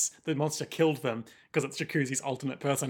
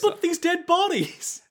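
high, slow mock alarm; hammed-up horror at the corpses. Acts out Jacuzzi's protest.

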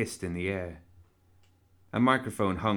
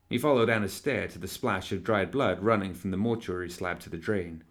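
usual speaking voice

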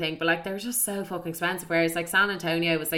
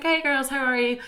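higher-pitched friendly hello; chatty greeting; 7 semitones higher than usual. Retold or imitated. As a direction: greet strangers sweetly, pitched up. Acted friendly approach to strangers.

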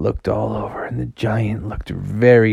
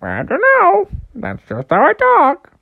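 high, squawking bird; parrot-like goose; 4 dB louder than usual. Acted. Goose explaining its parrot voice, squawky. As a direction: answer in a parrot's high screech. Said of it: "Why do you talk like a parrot?".

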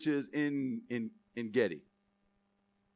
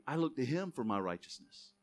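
ordinary talk

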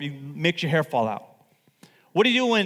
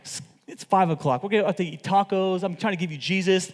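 usual speaking voice

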